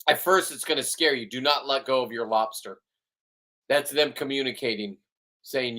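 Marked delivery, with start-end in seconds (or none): none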